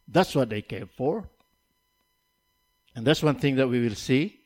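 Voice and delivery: ordinary delivery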